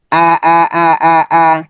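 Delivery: clear, repeated Arabic syllables, each one said crisply; syllable drill. Shown as a demonstration. Teacher drills students on letter sounds, crisp repetition. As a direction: sound out তি again and again for the class. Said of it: "আমরা এইভাবে practice করবো".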